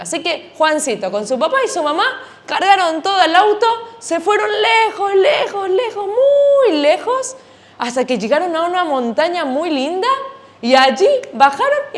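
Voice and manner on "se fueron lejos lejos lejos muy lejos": each lejos climbing higher and slower; drawn-out emphasis. A narrator's reading. Telling children how far the family drove, drawn-out and rising. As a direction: stretch lejos out, far, far away. Storyteller stretches the distance.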